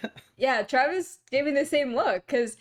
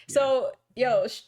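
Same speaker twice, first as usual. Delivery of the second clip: normal talking voice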